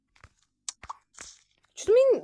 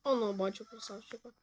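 normal talking voice

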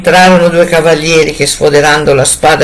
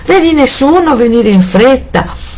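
higher anxious call to the tower; wife calls to her sister on the tower; 10 semitones higher than usual. A narrator's reading.

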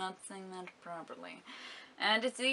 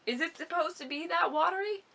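higher-pitched mock skeptic; doubtful viewer; 6 semitones higher than usual. Vlogger voices viewers who doubt the lotion, mock doubtful. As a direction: lightly imitate a disbelieving viewer, pitch climbing. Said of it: "and you'd be like".

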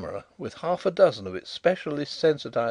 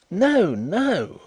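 higher, louder indignant protest; indignant cry; 8 semitones higher, 5 dB louder than usual. A narrator's reading. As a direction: cut him off in outrage. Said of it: "cried Mister Carlyle indignantly".

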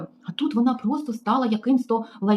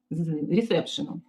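usual speaking voice

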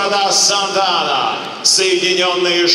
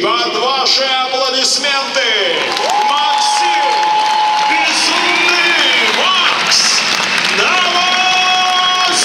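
announcer call-out; 6 semitones higher than usual. Real, not acted. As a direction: build the hype and boom the name. Ring announcer calls fighter name.

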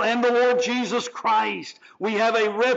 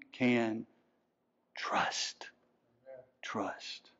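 breathy whisper of trust; 9 semitones lower, 12 dB quieter, much slower than usual. Acted. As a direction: whisper the word like a secret. Whispered emphasis closing sermon point.

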